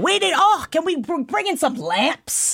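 high, exasperated decorator; hammed-up fussy demands, pitched high; put-on voice, fretting over the decor; Amarantha coming in. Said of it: "Amarantha coming in and be like".

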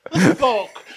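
high, loud mock drunken outburst; drunk Daemon. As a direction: cry out like an indignant drunk. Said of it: "Daemon's still drunk".

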